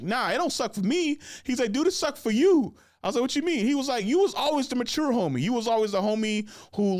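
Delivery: high, loud mock-insistent ribbing; hammed-up loud friend teasing. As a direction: push back playfully, pitch way up. Friend teasing and correcting him.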